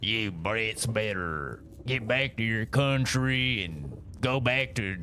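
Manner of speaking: mock American version. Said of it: "if I wanted to be my American version".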